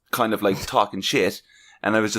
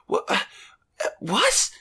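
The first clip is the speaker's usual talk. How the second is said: halting, high-pitched baffled what; 9 semitones higher than usual. Retold or imitated. Guest replaying his reaction to a stranger, baffled. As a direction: stammer it in stunned confusion. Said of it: "just kinda going".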